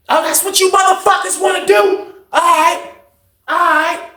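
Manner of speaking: high-pitched retold holler; imitating someone hollering in outrage; acted angry outburst. Acting out an angry outburst before pod.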